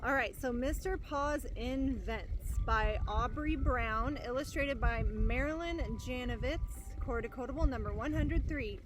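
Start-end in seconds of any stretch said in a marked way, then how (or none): none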